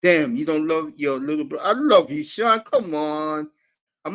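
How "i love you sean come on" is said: loud affection. Real, not acted. Affection to Sean.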